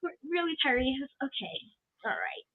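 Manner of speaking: very soft, deadpan, unimpressed; unimpressed mutter. Retold or imitated. Watching Terry on screen, soft unimpressed mutter. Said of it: "I was just like".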